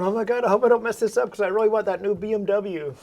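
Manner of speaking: mock a jittery doctor fretting aloud; nervous doctor. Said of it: "the doctor walks in all nervous".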